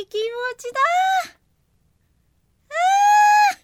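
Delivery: high, giddy little thunder imp voice; bouncy young imp, pleased with himself; excitable thunder imp. Acted. Thunder imp's voice in the story. (高い声の元気な雷小僧)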